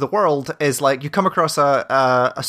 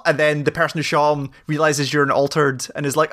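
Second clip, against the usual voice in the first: ordinary delivery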